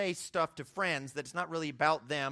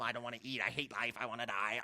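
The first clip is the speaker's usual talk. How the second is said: softer, lower hammed-up self-pity; mock despair; 5 semitones lower, 6 dB quieter than usual. Preacher mimics his younger self wallowing at home, mock despair. Retelling moping alone at home.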